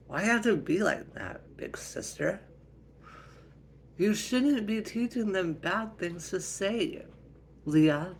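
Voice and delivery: high, loud, indignant complaint; exasperated family squabble; scolding sister. Acted. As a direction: tell off your sister, pitch climbing. Mother scolds her sister.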